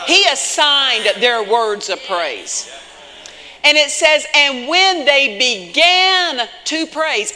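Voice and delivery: forceful preaching with stretched key words. Real, not acted. Preacher on praise at the very beginning, forceful preaching. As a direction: drive each phrase home to the congregation.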